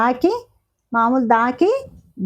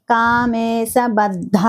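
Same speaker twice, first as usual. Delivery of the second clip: కామేశబద్ధ spelled out slowly, each syllable apart; much slower than usual. Shown as a demonstration. Teacher sounding out a name for students, slow and careful. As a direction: drill కామేశబద్ధ slowly for learners. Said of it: "ధ అన్నప్పుడు".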